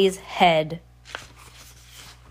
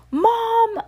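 horrified; 3 dB louder than usual. A narrator's reading. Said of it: "Heidi whispered".

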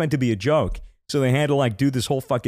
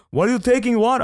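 high, louder accusing question; accusing clerk; 11 semitones higher, 4 dB louder, faster than usual. Retold or imitated. Retelling the clerk accusing him, high pitched. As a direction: mimic the clerk's suspicious challenge. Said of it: "He was like, he was like".

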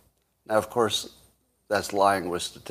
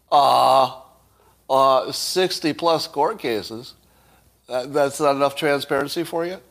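hammed-up sarcasm; 3 semitones higher, 3 dB louder than usual. Repeating the critic's jab to mock it: exaggerated sarcasm. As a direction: ham up the smug critic, milking each "Really?". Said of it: "And Ellen says sarcastically".

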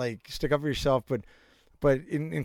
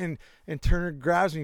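ordinary talk, nothing marked

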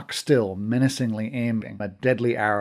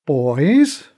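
a louder, clipped call, patience running out; tired of waiting for them; impatient; 3 semitones higher, 7 dB louder than usual. A narrator's reading. Mom cutting off the masters' squabble, impatient. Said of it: "This time she sounded impatient".

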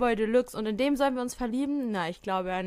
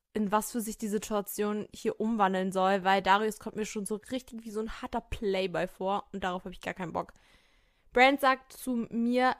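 ordinary talk, nothing marked

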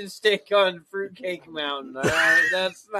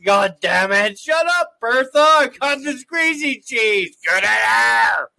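loud, high shouting man; big old man shout; 5 semitones higher, 8 dB louder than usual. Retold or imitated. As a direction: bellow it like a rough trucker. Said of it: "Big old man voice".

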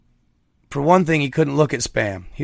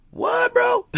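baffled squeak. Retold or imitated. Repeating grandpa's baffled reaction.

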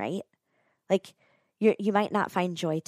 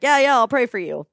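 dismissive promise; 5 semitones higher, 8 dB louder than usual. Retold or imitated. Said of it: "everyone's like".

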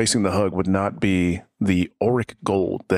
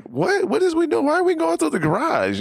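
squeaky high, startled voice; high confused shriek. Retold or imitated. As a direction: shriek it out in disbelief. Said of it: "I'm like".

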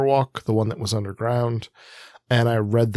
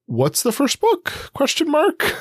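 mock-baffled, pitched way up and slowed; baffled question; slower than usual. Recalling bookstore confusion to cohost, high baffled voice. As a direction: play his own past confusion, high and drawn out. Retelling own confused search.

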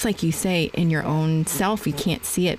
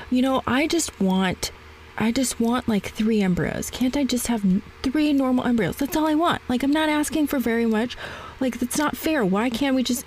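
higher-pitched, plaintive bargaining; retold pleading; 6 semitones higher than usual. Patient bargaining with her therapist, pleading. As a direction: plead that it isn't fair, replaying yourself. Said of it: "three stages of grief".

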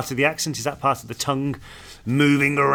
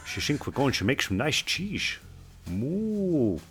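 softer mock cow voice with a put-on Dutch lilt; 8 dB quieter, much slower than usual. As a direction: moo like a lazy Dutch cow in a field. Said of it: "the Dutch cows just stand in the field like".

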